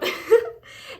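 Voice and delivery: sudden giggling burst; high breathy laugh. Real, not acted. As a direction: laugh out loud, high and airy. Laughs at her own burp.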